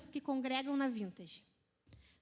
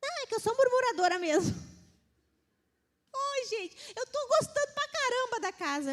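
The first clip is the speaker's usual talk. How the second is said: much higher, quicker silly self-excusing voice; high mock complainer; 9 semitones higher than usual. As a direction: squeak out the excuse in fun. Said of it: "tu não tá gostando de morar".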